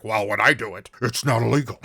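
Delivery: playing Destro with a thick Scottish accent. Quoting Destro to the host in a Scottish accent. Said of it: "But in a Scottish accent".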